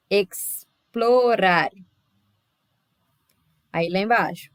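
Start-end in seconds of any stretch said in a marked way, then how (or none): 0.1-1.7 "Explorar" broken as ex, pause, plo-rar; syllable by syllable. Shown as a demonstration. Teacher showing the exception for L, syllable by syllable. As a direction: say "Explorar" very slowly, pausing after the first syllable. Shows how the word divides.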